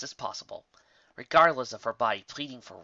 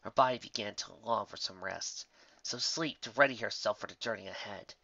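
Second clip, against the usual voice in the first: ordinary delivery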